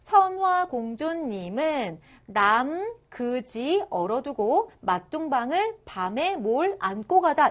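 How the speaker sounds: put-on slow, deliberate reading of an old verse; measured read-out. Shown as a demonstration. Teacher reads the old verse to students, measured read-out. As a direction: read it out phrase by phrase, slowly. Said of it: "이렇게 읽었다라는 거죠".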